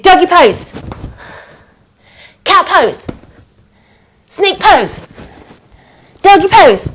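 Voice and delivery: high-pitched, punchy pose commands; lively calls with falling endings; excited game calls. Real, not acted. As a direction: call each pose out like a quickfire game. Rapid calling of poses in game.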